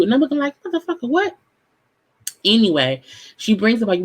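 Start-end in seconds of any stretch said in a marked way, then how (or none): 0.6-1.4 high, stunned what; shocked disbelief. Retold or imitated. Host reacting to a contestant's insult in shock. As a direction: recoil in total disbelief.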